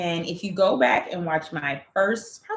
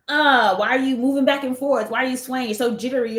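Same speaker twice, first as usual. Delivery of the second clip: higher-pitched imitation of complaining viewers; mock nagging commenters; 6 semitones higher, 4 dB louder than usual. Creator retells critical comments on their video, mock nagging. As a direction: pick at them like a nagging commenter. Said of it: "dogging me in the comments".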